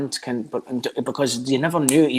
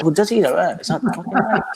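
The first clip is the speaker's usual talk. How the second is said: higher, louder retold comeback; mock indignant challenge; 8 semitones higher, 4 dB louder than usual. Confronting a podcaster about an insult, indignant challenge. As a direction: throw it back as an affronted challenge.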